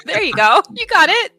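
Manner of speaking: laughing. Real, not acted. Laughing at the impression.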